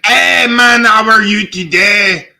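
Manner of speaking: chirpy French Canadian accent, hammed up; a pretty French Canadian girl greeting someone, mock accent. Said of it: "she's like".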